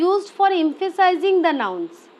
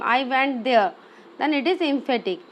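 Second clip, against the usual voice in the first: ordinary talk, nothing marked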